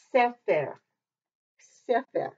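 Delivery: Sefer with stress on the first syllable, SE-fer; correct Hebrew stress. Shown as a demonstration. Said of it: "you always put the accent on the first syllable".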